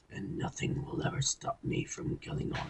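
whispered villain threat; menacing villain whisper. Retold or imitated. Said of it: "he would be like".

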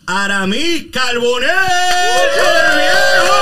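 big, drawn-out announcer build; announcer introduction. Real, not acted. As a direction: stretch the name out and rise to a shout. Host introduces guest to applause.

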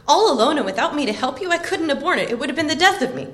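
acted upset, loud and high; indignant protest. A narrator's reading. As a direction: protest at being left behind, pitched well up. Sam protests being left behind.

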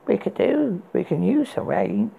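high, calm enchantress; cool sorceress musing. A narrator's reading. Enchantress voiced in story.

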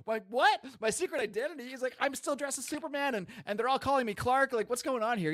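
a flustered Superman who can't make sense of anything; high, bewildered mock Superman; panicked Superman. Superman voiced, confused and panicked.

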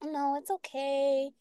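leise, langsam, tröstend wie zu einem Kind; soothing sugary voice. Retold or imitated. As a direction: ganz sanft beschwichtigen. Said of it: "But then you'll have some, like".

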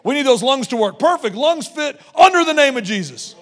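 loud, high-pitched preaching; impassioned preaching. Real, not acted. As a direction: declare it with full pulpit force. Preaches at full force.

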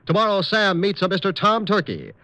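normal talking voice

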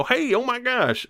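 high, hammed-up delighted cry; mock delight. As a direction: squeal it like a thrilled farmer. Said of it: "and they go".

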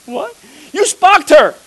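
high, loud incredulous echo; can't believe what he just heard; baffled disbelief. Retold or imitated. Preacher retelling his stunned reply to the heckler, high disbelief.

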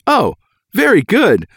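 high, slow, delighted read; acted approval, sing-out high; pleasantly impressed nurse. Nurse pleased by a good result.

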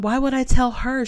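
incredulous. Real, not acted. Telling listeners about the accusation, a high incredulous question. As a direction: ask it as if it's absurd.

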